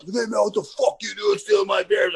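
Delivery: mock tough-guy challenge; angry cowboy. Angry cowboy confronting him.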